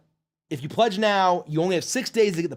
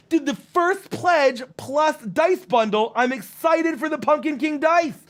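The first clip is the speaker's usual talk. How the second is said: slow, higher, gleeful reading; excited chat read; 7 semitones higher than usual. Acted. Streamer reading a fan's dice order, excited. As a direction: read it out like it's thrilling news.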